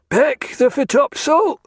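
high, loud order called forward; pilot calling out. A narrator's reading. Pilot ordering sail change, called out loud. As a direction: call the order across the ship. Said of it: "called the pilot".

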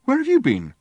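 high, loud demand. Acted. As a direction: demand it sharply. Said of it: "he demanded".

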